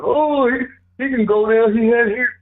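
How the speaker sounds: high, loud whiny mock of an excuse-maker. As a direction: ham up a fan's lame excuse. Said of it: "they make excuses".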